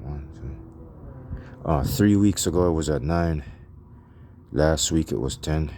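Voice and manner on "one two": whispered counting to himself; whispering. Real, not acted. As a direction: count under your breath. Counting weeks under his breath.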